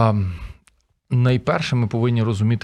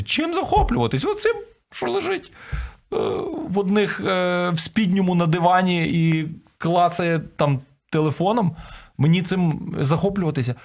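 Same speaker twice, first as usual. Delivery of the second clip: mock-indignant wife, voice pitched way up; scornful disbelief; 8 semitones higher than usual. Wife asked to admire her idle husband, indignant. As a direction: object incredulously in a high put-on voice. Acts out wife's sceptical objection.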